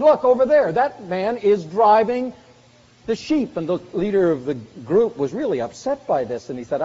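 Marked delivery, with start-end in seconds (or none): 0.0-3.6 louder, higher pointing out; excited tourist call. Retold or imitated. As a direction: call out in surprise to the group. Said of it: "and they said".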